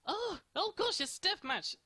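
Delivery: sudden high alarmed cry; startled. Real, not acted. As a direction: jolt of shock as the fight starts.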